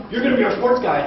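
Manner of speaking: quoted interviewer. Retold or imitated. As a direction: hand him the sports beat with a grin.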